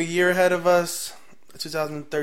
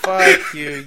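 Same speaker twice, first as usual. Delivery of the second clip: mock outrage; 4 semitones higher, 12 dB louder, much slower than usual. Real, not acted. Teased about an embarrassing tweet.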